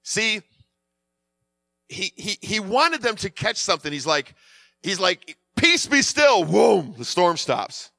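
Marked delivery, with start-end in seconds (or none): 5.5-6.5 loud, high command. Retold or imitated. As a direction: call it out to still a storm. Acting out Jesus commanding the storm.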